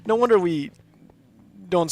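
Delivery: breaking up laughing at the word; laughing astonishment. Real, not acted. Vlogger laughing at a strange pronunciation, loud and high.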